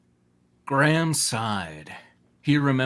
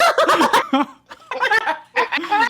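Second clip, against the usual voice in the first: loud high laugh; high burst of laughter; 6 dB louder than usual. Real, not acted. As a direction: explode into laughter.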